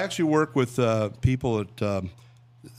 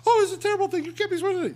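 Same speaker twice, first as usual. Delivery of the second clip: very high, louder falsetto nun; scolding nun; 4 dB louder, much faster than usual. Retold or imitated. Nun caddy scolding the priest, high falsetto. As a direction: squeak out the reproach like a prim nun. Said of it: "the sister goes".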